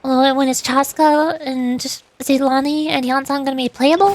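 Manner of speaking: normal talking voice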